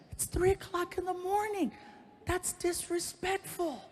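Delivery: put-on a prissy girlfriend scolding him; high girlfriend voice. Woken girlfriend scolds him at three am, in a high prim voice.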